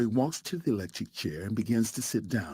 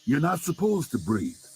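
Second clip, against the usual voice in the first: higher-pitched, restrained narrated yell; yelling back; 6 semitones higher, 3 dB louder than usual. A narrator's reading. Executioner answering the choking prisoner, restrained yell back. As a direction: yell it back, partly held in. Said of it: "The executioner yells back".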